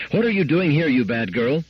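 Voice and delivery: high scolding cry; mother's worry turning to a sharp telling-off; anxious anger. A narrator's reading. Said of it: "cried Missus Weston, anxiety dissolving at once into anger".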